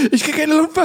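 noch höher und lauter, gesteigert. Retold or imitated. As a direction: Panik steigt, fast schreiend. Repeated, higher and louder. (gesteigerte Panik, höher)

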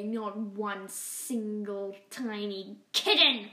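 villain rat vowing to rule; ranting Rat King. Acted. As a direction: declare your conquest with menace. Rat King's villain speech.

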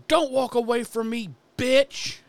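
hammed-up rage, voice flying up; imitating a furious yell. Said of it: "all of a sudden he goes".

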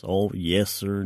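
usual speaking voice

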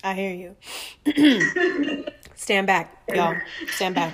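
hyped up. Real, not acted. Host reacting to guest's story, loud and fired up. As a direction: rev up like she's ready to fight.

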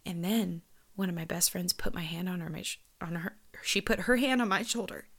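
ordinary delivery

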